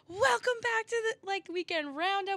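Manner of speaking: high-pitched gushing podcast welcome; over-excited show intro. Retold or imitated. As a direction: imitate an over-eager host opening a first episode. Mocking their own first episode intro.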